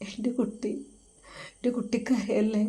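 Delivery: 1 tearful mother. A narrator's reading. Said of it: "ഉമ്മയും കരയാൻ തുടങ്ങി".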